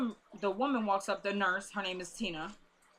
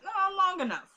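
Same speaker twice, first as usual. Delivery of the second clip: teasing reveal; 10 semitones higher, 4 dB louder than usual. Retold or imitated. Friend caught watching, teasing.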